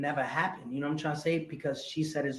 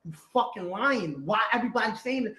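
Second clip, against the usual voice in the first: higher, heated retold accusation; imitating your own furious outburst; retold raging; 7 semitones higher, 3 dB louder than usual. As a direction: replay an angry challenge, pitch up. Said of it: "I'm, I'm, I'm raging".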